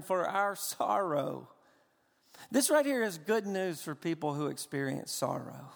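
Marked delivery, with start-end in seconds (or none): none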